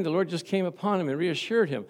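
usual speaking voice

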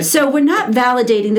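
ordinary delivery

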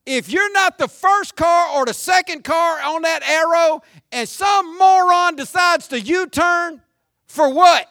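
shouting about traffic; exasperated shout. Real, not acted. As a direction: vent at the road's morons. Pastor ranting about U-turning drivers.

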